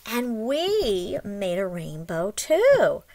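usual speaking voice

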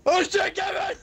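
high, loud cry to a friend; alarmed shout. Real, not acted. Player watching his buddy drop, alarmed yell. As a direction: yell his name in panic.

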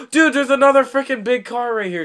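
high, excited surprise; gasp, then blurt it out high; surprised gasp. Real, not acted. Spots a big car.

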